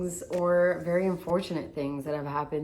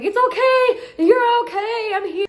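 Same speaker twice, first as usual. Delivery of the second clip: much higher, louder, slow soothing; calm her down urgently; frantic reassurance; 9 dB louder than usual. Retold or imitated. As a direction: tattooer reassuring the terrified client, soothing. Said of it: "I was like".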